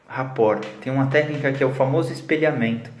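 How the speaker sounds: ordinary delivery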